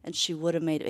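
ordinary talk, nothing marked

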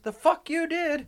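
high, slow mock outrage; indignant denial. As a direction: imitate a father stretching out his denial. Dad's angry denial, retold.

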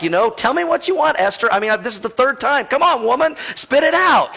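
a king losing patience with his wife; impatient king. Retold or imitated. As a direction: hurry her up like a fed-up husband. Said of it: "notice the king's like".

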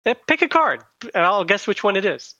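high carnival barker voice; showman pitch. Acted. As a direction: sell it like a street magician. Showman card trick.

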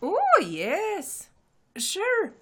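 high, eager little shopkeeper voice; gnome woman. Acted. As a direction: chirp it up like a tiny helpful clerk. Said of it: "gnome woman replies".

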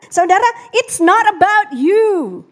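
slow, stress on not; preacher's slow emphatic claim; emphatic declaration. Acted. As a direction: land the word not.

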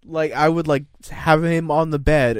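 ordinary talk

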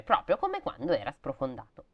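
plain reading voice

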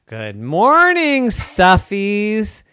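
slow, high drawn-out teacher greeting; slow sweet greeting. Acted. Girl greets her stuffed animals slowly.

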